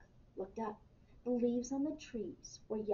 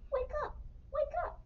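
very high-pitched ladybug voice; urgent little cry. A narrator's reading. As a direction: squeak it to rouse a sleeping bear. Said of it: "cried Ladybug".

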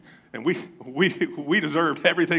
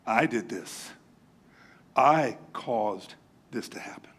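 much lower and softer, slow confession; remorseful David; 8 semitones lower, 5 dB quieter, slower than usual. Retold or imitated. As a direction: let the guilt weigh each word, slowly. David confesses his sin.

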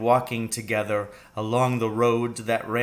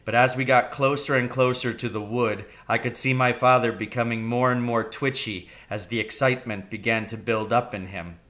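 plain reading voice

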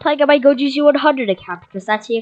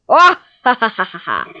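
mock evil laugh; 4 dB louder than usual. Gamer boasting of kills, mock gloating laugh. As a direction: cackle like a pretend villain.

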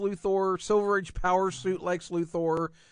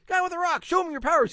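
high mock voice; 10 semitones higher, faster than usual. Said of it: "He goes".

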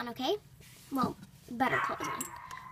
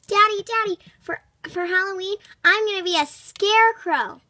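put-on excited child; 4 semitones higher, 6 dB louder than usual. Child eagerly tells dad costume.

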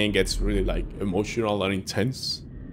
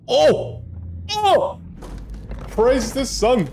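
high, exultant cry; gleeful high exclamation; excited cheer; 12 semitones higher, much slower than usual. Pretend. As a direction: whoop with joy, pitched way up. Exultant cheer at game discovery.

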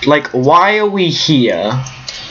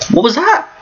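ordinary talk

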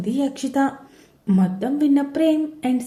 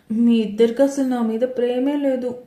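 plain reading voice

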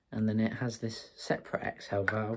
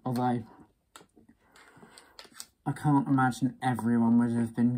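muffled behind rubber respirator; speaking with tube between teeth; through a gas mask; 5 semitones higher, 8 dB louder than usual. Real, not acted. Seen 5: Gas mask worn over face throughout; drinking tube held in teeth.

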